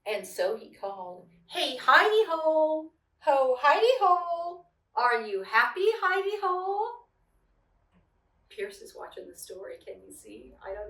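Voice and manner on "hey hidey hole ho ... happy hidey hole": sing-song call, high and loud on hidey; lilting rabbit call into the hole, raised pitch and louder. A narrator's reading. Rabbit calls teasingly into the hidey hole, sing-song.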